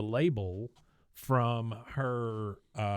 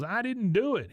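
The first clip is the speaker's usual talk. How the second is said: high-pitched indignant denial; mock outrage; 11 semitones higher, 4 dB louder than usual. Bobby denying he spat dirt, high mock outrage. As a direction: protest with hammed-up indignation. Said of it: "he's outraged".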